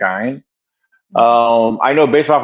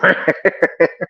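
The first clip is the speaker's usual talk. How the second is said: real higher-pitched chuckle; amused laugh; 6 semitones higher than usual. Real, not acted. Amused by grandson banging door.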